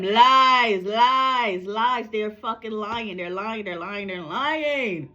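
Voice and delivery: drawn-out chant of denial, swelling then dropping away; angry rant. Real, not acted. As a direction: repeat it like an angry chant, stretching the words. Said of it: "I'm getting a little angry right now".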